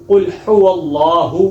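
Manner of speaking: قل هو اللہ with the first ha light, the last heavy; slow demo of قل هو اللہ with uneven ha; wrong mixed ha. Shown as a demonstration. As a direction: mistaken uneven ha sounds. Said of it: "یہ بھی غلط ہے".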